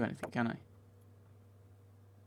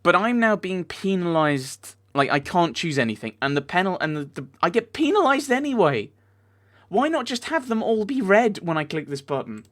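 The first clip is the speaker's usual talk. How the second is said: loud, high exasperated complaint; frustrated rant; 7 semitones higher, 9 dB louder than usual. Real, not acted. Player penalized whatever they choose, frustrated rant. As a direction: rail loudly at the unfairness of it. Rants at being penalized by game.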